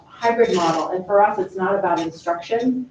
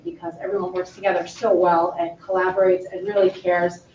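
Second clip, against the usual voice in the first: ordinary talk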